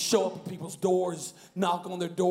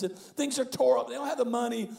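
ordinary talk, nothing marked

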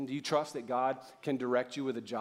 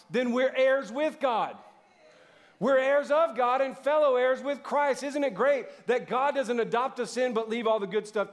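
higher, louder, slowed-down climactic preaching; rising revival-style sermon climax; preaching at full force; 7 semitones higher, 5 dB louder than usual. Acted. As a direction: drive the promise home to the congregation.

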